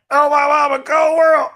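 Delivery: high, loud lament over prices; loud exclamation. Real, not acted. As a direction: cry out in outraged disbelief.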